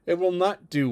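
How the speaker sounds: ordinary delivery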